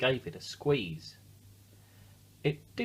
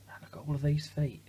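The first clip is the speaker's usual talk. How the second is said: whisper; 5 semitones higher than usual. Real, not acted. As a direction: breathe it like a shared secret.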